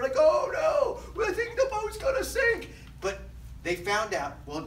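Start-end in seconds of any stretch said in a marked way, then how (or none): 0.1-2.7 high, loud panicked wail; scared sailors. Acted. Sailors on a boat shaking in the storm, mock panicked shout. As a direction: cry out in hammed-up terror. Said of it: "the people on the boat were all scared".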